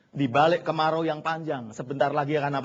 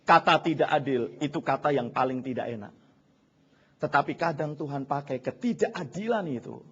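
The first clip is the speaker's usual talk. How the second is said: usual speaking voice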